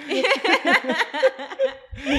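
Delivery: laughing through mock tears; high burst of laughter. Real, not acted. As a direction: break into a big laugh. Laughing at her own tears.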